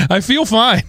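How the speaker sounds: high, perky insistence; mock-cheerful. Sick host assuring co-hosts he is okay, mock-cheerful. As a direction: pitch it way up, playfully overdoing it.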